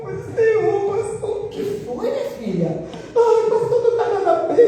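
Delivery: mock tearful cry to the pastor; distressed outburst. Retold tearful talk with pastor.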